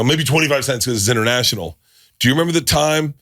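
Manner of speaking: ordinary delivery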